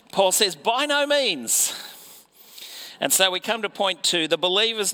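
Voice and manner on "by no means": high, slow and emphatic on NO; an emphatic, slow "By no means" with "no" lifted high. Retold or imitated. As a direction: land "no" hard, high and drawn out. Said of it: "it's an emphatic sort of a no".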